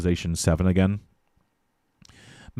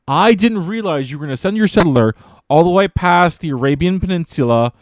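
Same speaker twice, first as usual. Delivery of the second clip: exasperated sarcastic apology, higher and louder; mock apology; 6 semitones higher, 5 dB louder than usual. Aggrieved player to Egypt over a settler in China, sarcastic. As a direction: lay the fake sorry on thick, pitched up.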